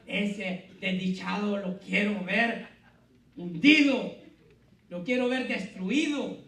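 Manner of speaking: slow, bitter wish, bursting loud on hundido; vengeful grudge. Acted. Pastor voicing the believer's vengeful heart, slow and bitter. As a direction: spit out a wish for revenge.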